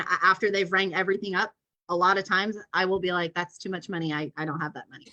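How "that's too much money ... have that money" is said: low, quiet straight-faced delivery; dry, understated deadpan; deadpan joke. Retold or imitated. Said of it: "you say it with a straight face".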